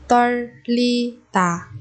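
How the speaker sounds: 떨리다 slowly, each syllable held. Shown as a demonstration. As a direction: break 떨리다 into drawn-out syllables. Teacher models word syllable by syllable.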